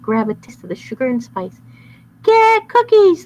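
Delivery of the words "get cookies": very high, slow loud shout. Acted. Bad boys pouncing on cookie, shouting. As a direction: yell the charge. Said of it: "they shouted".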